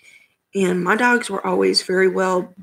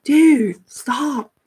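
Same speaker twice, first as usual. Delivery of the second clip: exasperated yell; 6 semitones higher, 7 dB louder than usual. Retold or imitated. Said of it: "And I'm like".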